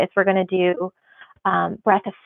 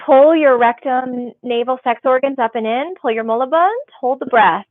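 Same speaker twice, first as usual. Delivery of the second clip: yoga instructor cues; 4 semitones higher than usual. Acted. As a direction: call the breath cues to the class. Calls cues during breathing exercise.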